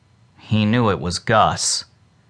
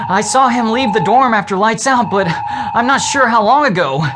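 put-on higher young voice, a bit louder; boy speaking up; 9 semitones higher, 3 dB louder than usual. A narrator's reading. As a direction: speak up nervously to the teacher.

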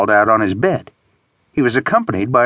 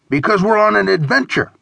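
high, emphatic character voice; insistent friend; 11 semitones higher than usual. A narrator's reading. Friend arguing to stay on the trip, insistent. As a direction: insist on it in a higher voice.

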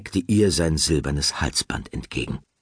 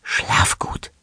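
whisper. Acted. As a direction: whisper it so nobody wakes. Goodnight whispered in bed.

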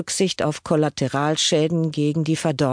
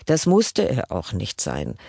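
ordinary delivery

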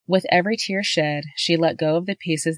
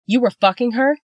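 higher and louder, furious disbelief; outraged accusation; 7 semitones higher, 5 dB louder than usual. A narrator's reading. Wife confronts her husband on the phone, higher and louder furious accusation. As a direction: spit the question at him.

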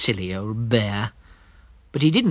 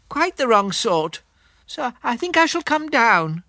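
high, earnest little bear voice; a thoughtful teddy bear making a decision; Pooh voice; 10 semitones higher than usual. Acted. Pooh voice in the story.

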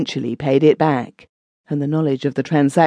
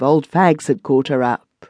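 ordinary delivery, nothing marked

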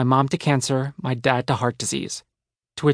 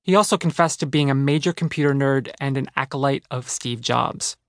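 ordinary delivery

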